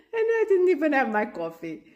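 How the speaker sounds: fast, laughing through the words. Real, not acted. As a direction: giggle it out quickly. Laughing aside.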